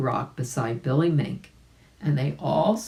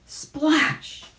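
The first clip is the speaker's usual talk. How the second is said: splash sound effect; 12 semitones higher than usual. A narrator's reading. Narrator voices the splash.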